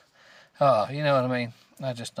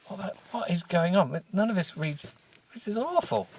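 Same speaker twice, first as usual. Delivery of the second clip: rising, high-pitched disbelief; exasperated; 6 semitones higher than usual. Real, not acted. Reader gives up on a garbled book, exasperated. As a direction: climb higher with each baffled complaint.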